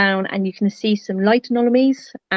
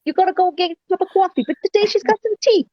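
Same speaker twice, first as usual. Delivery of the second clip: put-on New Jersey accent; 10 semitones higher than usual. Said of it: "[name], you do the best New Jersey accent".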